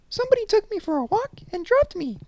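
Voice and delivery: tiny squeaky teddy voice; high and small like a toy bear; little bear voice. Acted. Toby Small's voice.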